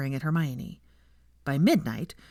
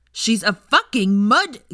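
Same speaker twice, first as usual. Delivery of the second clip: slow, much louder furious slur; outraged; 6 semitones higher, 8 dB louder, slower than usual. A narrator's reading. Millicent to Pansy, loud slow raging outburst. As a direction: spit the insult in fury.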